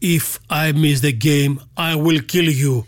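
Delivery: put-on slow, menacing Serbian accent; Serbian-accented English. Serbian ex-basketball giant threatening the American bus driver, slow Serbian accent. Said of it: "όπως θα σας το κάνω με την προφορά αυτή".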